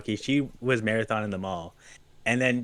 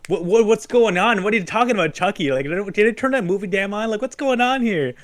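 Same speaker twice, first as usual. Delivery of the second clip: alarmed dad; 8 semitones higher, 5 dB louder than usual. Retold or imitated. Retold dad rushing in confused.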